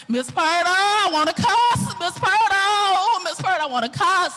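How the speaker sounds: hammed-up pleading, stretched names; exasperated wail. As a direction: wail it like you're at your limit. Acted plea to her confidante.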